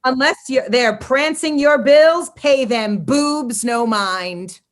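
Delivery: slow, drawn-out mock-proclamation; annoying voice. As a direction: read it like an annoying, pompous manifesto. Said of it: "read it in this annoying voice".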